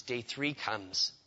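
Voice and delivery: normal talking voice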